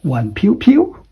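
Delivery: "Wan piu piu" in Cantonese tones, rising at the end; Cantonese phrase. Shown as a demonstration. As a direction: quote "Wan piu piu" as Cantonese, lifting the last "piu". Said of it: "just like in Cantonese".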